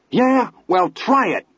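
high braying taunt. A narrator's reading. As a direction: bray it out wildly. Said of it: "Teddy brayed suddenly".

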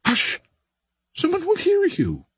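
high, anxious shushing; urgent hushing. A narrator's reading. Barbara warns Billy someone will hear, anxiously hushing. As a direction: hush him urgently.

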